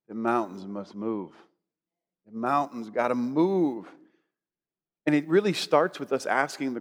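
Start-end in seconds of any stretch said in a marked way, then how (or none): 0.1-1.3 low, soft declaration; quiet conviction. Shown as a demonstration. Preacher declaring to the mountains, low quiet conviction. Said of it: "strength with all conviction". 2.3-3.9 low, slow declaration with a long MOVE; drawn-out conviction. Shown as a demonstration. Preacher restating his declaration to the mountains, slow and drawn-out. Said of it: "strength with all conviction".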